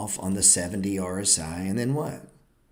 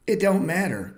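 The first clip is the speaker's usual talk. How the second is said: higher, louder brushing it off; loud dismissal; 10 semitones higher, 6 dB louder than usual. Real, not acted. Host dismissing pundits' opinions, loud and sharp. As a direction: shout it doesn't matter.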